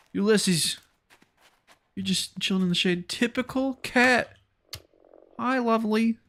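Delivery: high, cooing fussing at little animals; pet talk. Real, not acted. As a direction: talk to pets in a high playful voice. Fussing over the cat.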